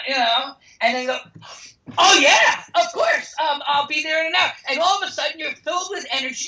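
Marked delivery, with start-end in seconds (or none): 2.0-4.5 much higher, louder, fast and thrilled; eager yes. Pretend. Acting someone accepting an old friend's invite, thrilled and fast. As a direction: jump at the invitation.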